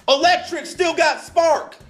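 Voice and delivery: slow, high, loud retort; angry old man. Retold or imitated. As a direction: interrupt loudly, imitating a stubborn old man. Imitates the shouting older man.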